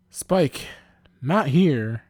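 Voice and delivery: high, loud telling-off; loud embarrassed protest; scolding outburst. A narrator's reading. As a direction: cut him off sharply and loudly. Embarrassed Buffy scolds Spike.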